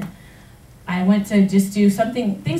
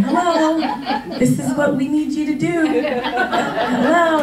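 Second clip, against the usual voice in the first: laughing, higher sing-song hello; mock calling out; 7 semitones higher, much slower than usual. Product waving at him, laughing mock hello. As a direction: tease it like the answer was waving at you.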